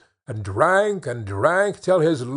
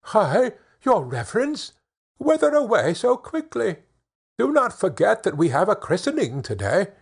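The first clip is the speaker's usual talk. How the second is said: calling out; 6 semitones higher than usual. A narrator's reading. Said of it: "and called out".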